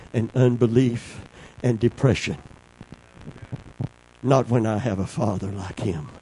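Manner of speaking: low, soft, intimate preaching; hushed preaching. Real, not acted. Preacher speaking softly of the Father's love. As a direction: drop to a tender hush.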